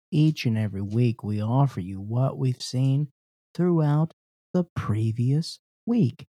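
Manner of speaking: mock concert announcer intro. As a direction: introduce the band like an emcee. Hosts a concert-style introduction.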